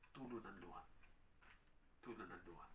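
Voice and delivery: low, very soft and solemn; hushed reveal. Real, not acted. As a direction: let it drop to a hush after the question. Solemn answer to own question. (mahina, mababa at taimtim)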